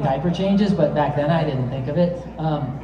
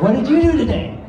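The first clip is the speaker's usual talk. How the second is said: humouring question; 8 semitones higher, 5 dB louder than usual. Retold or imitated. Asks guy about his day.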